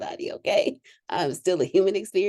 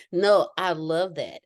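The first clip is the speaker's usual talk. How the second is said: normal talking voice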